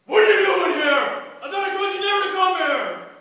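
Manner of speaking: furious, booming yell; full-force outraged confrontation; angry shout. Acted. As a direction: bellow it at an intruder, pitched high. Acted angry shout overheard from inside.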